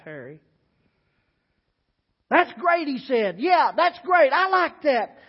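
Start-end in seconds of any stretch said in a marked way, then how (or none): 2.3-5.2 much higher and louder, bursting with delight; light up at a great idea; gruff cook suddenly thrilled. Acted. Harry delighted by party idea.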